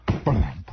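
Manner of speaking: very low, popped "blump"; imitated tail thump. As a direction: thud it out deep and short. Imitating the tail's thumping sound.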